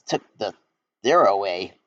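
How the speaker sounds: ordinary delivery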